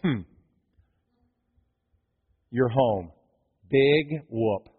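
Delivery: low, soft, bored dismissal; unimpressed. Acted. Hometown family brushing off Jesus, low and unimpressed. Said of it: "they're all sitting there going".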